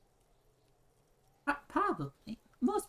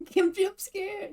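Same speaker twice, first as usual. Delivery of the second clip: high, drawn-out words through a laugh; amused, laughing; 11 semitones higher, much slower than usual. Real, not acted. Streamer joking that Kim jump scared everyone, laughing. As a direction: giggle it out slowly.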